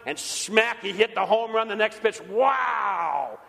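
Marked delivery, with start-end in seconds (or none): 2.2-3.4 mock amazement. Preacher marvelling at Babe Ruth's home run.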